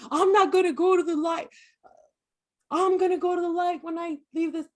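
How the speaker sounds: high, loud scoffing take; mocking quote. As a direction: mimic what people claim. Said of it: "when people say".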